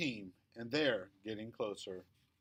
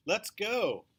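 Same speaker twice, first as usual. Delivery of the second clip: Mom calling up the stairs, higher; 8 semitones higher, 5 dB louder than usual. A narrator's reading. Mom calls her son down from downstairs, calling out. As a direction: call it up from downstairs. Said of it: "calls Mom from downstairs".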